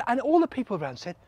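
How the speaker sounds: ordinary delivery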